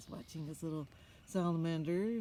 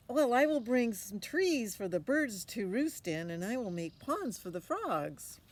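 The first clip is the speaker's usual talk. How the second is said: put-on confident boy; 6 semitones higher, 8 dB louder than usual. Brian answers.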